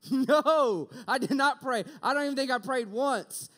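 shouted high, half-laughing admission; loud sheepish confession. Retold or imitated. Admitting to Christy he never prayed, loud and sheepish. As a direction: own it with an embarrassed laugh.

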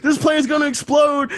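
high-pitched mock kid outburst; panicked kid. Retelling the movie kid's warning, high mock panic. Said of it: "and then the kid's like".

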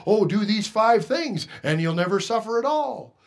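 a hint of a glib preacher; mock prosperity preacher. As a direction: sell it like a cheap pitch. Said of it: "In our churches today, we have".

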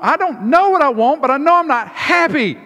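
high, loud tantrum whine; whiny toddler. Retold or imitated. A tired upset son refusing to give up cake, whining high and loud. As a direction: play a tired kid clinging to his cake. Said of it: "upset son".

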